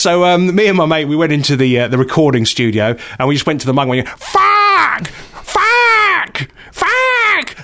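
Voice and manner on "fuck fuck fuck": very high, drawn-out gull squawk; pretend seagull. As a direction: screech the word like a seagull. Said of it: "by pretending to be seagulls".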